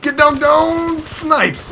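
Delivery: loud, high warning call; urgent high-pitched callout; urgent shout. Real, not acted. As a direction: yell a sniper warning to a teammate. Warning teammate of sniper.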